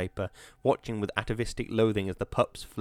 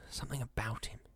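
whisper; 11 dB quieter than usual. A narrator's reading. Narrator's ominous aside.

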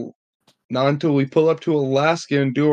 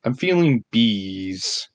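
mock pondering; 3 semitones lower, much slower than usual. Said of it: "And I was like".

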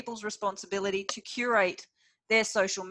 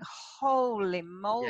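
wide-eyed awe; slower than usual. Retold or imitated. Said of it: "wide-eyed going".

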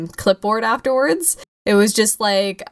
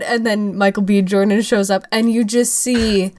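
ordinary talk

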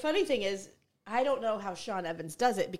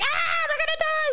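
a much higher, louder mock panicked yelp; Bear Grylls panicking; 5 dB louder than usual. Mocking Bear Grylls yelping in panic in the wild, much higher and louder. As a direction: squeal it like a hammed-up panic. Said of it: "if Bear Grylls is like".

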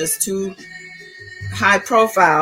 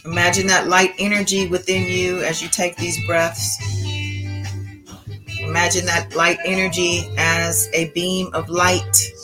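calm meditative tone. Acted. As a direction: guide them gently into calm breathing. Leads a guided breathing meditation.

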